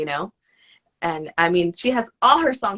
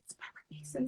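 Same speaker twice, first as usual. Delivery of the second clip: whispered aside; 15 dB quieter, much faster than usual. Retold or imitated. Whispered to mother.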